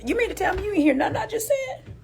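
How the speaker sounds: high, fast disbelieving question; incredulous. Real, not acted. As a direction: ask it like you can't believe it. Incredulous voice to the woman.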